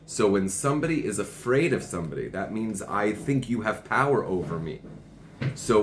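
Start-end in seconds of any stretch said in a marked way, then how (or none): none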